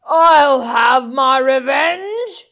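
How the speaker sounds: loud, slow villain vow; furious rat shout. Acted. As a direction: bellow the threat. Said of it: "he vows with a shout".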